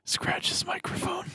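playful ASMR whisper. Acted. Continuing the ASMR whisper joke.

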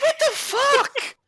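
a high, stretched-out what the fuck, slow with disbelief; shocked outburst. Real, not acted. Reacting to a crude joke about him, a high, slow shocked yell.

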